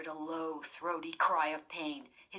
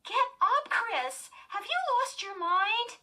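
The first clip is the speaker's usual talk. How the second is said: exasperated; 6 dB louder than usual. A narrator's reading. Lindy scolding her sister.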